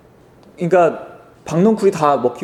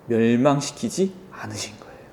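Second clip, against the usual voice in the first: hushed, secret-telling whisper; whispered reveal; 5 semitones lower, 6 dB quieter than usual. Real, not acted. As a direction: drop to a whisper for the big reveal. Dramatic reveal of the outcome. (속삭이듯 밝히는 결말)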